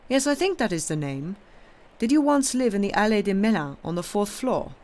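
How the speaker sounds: put-on priest's probing questions in an Italian accent. A narrator's reading. Priest checking the innkeeper's identity, Italian accent. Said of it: "with a very heavy Italian accent".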